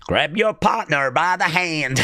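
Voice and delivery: lightly done Texas square dance call; hoedown caller. Retold or imitated. Mocking the Texan girl, a mild Texas hoedown call. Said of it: "sounds like at any moment she's gonna break out into a hoedown".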